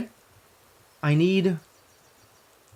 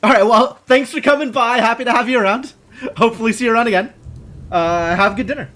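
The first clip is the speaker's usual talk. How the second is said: cheerful goodbye; 8 semitones higher, 5 dB louder than usual. Real, not acted.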